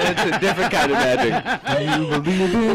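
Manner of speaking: high, slow and laughing. Real, not acted. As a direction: say it through laughter. Laughing joke.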